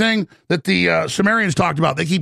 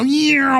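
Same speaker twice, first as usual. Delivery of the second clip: playful mock whoosh sound, pitched up; orbs whooshing off; 6 semitones higher than usual. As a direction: imitate little orbs zooming off with a quick "Yeah". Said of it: "like, ten seconds and go".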